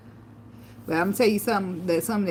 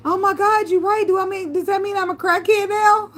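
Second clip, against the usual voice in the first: high, loud, alarmed realization; shocked disbelief; 11 semitones higher, 4 dB louder than usual. Retold or imitated. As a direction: gasp it out as if just caught. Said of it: "I said".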